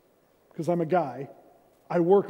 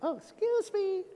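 much higher, prim and dainty little voice; mock a lady politely covering a burp; Rhonda's dainty excuse me; 13 semitones higher, 4 dB quieter than usual. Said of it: "Because she's like".

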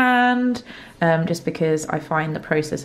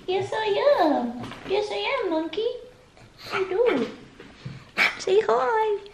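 very high, soft cooing; 4 dB quieter than usual. Real, not acted. Owner cuddling her little dog, high baby talk. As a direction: coo sweetly at a little dog.

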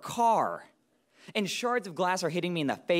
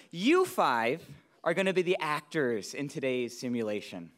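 ordinary talk, nothing marked

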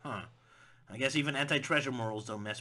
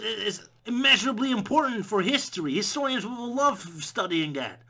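higher, louder frantic pleading; exasperated archaeologist; 5 semitones higher, 4 dB louder than usual. Acted. Scholar pleading with Indy over temple, frantic. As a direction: beg him to care about the temple. Voicing characters about temple.

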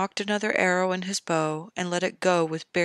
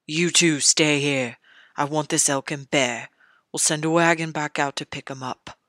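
gruff commanding king; 2 semitones lower, 5 dB louder than usual. Acted. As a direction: bark orders like a careless king. King Robert giving orders.

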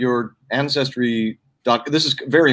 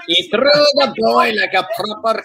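excited shout; 8 semitones higher than usual. Real, not acted. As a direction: sell the joke big. Laughing exaggeration.

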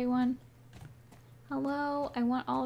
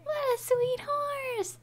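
cutesy high voice; slower than usual. Real, not acted. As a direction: coo at the sweet horse. Cooing at a horse.

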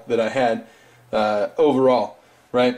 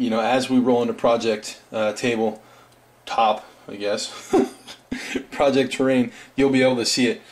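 ordinary delivery

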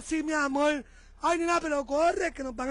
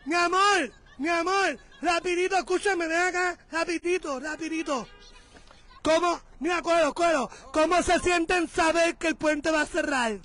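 flag them down by voice; calling out; 2 semitones higher, 6 dB louder than usual. Real, not acted. Vlogger calling a driver over to interview, loud calls.

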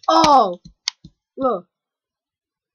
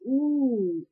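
normal talking voice

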